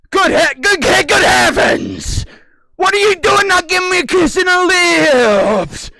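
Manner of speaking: high, loud put-on voice crying good heavens; hammed-up falsetto outrage; mock outraged shout. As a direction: shriek it like a scandalised old lady.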